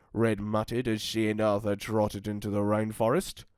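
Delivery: ordinary delivery, nothing marked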